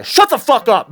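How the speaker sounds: exasperated shout at Alec Baldwin; angry outburst. Real, not acted. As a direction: snap at him in real anger.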